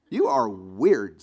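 slow, drawn-out you are weird; mock disbelief. Pastor mocking his friend's advice, slow and scornful.

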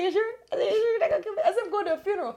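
very high, anxious questions to the cop; frantic pleading. Retold or imitated. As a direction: plead high and urgent, half laughing. Pleading with the officer.

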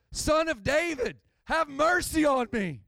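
high, urgent plea for mercy; desperate crying out. Acted. Blind man crying out to Jesus, desperate and high-pitched. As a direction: cry out as if it's your only shot. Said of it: "And he cries out".

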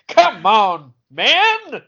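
loud, high, playful complaint; exasperated protest. Real, not acted. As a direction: voice jumping way up on man. Louder protest, voice jumps up.

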